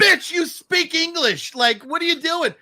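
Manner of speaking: high, drawn-out outburst; mock exasperation. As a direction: call out the character's pretence. Mock retort to the film character.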